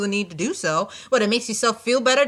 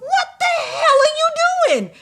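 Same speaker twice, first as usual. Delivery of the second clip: high, slow, outraged demand; angry dude; 6 dB louder, slower than usual. Retold or imitated. The dude with his hoodie yanked down, yelling high and slow. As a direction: act out the hooded dude's fury. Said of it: "The dude turns around and goes".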